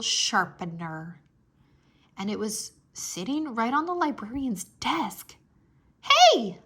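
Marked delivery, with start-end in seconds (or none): 6.0-6.6 a sudden high, loud cry of delight; light up at the sight of it; very excited. Acted. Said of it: "I said, very excited".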